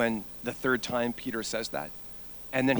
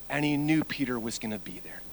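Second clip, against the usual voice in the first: normal talking voice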